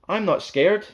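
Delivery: high, loud boast of courage; brave declaration. Acted. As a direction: puff up and insist. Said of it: "Dan declared".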